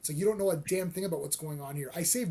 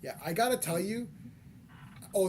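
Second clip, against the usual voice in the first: usual speaking voice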